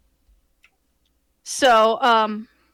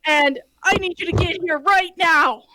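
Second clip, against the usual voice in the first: panicked necromancer; 5 semitones higher than usual. Retold or imitated. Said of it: "he's like".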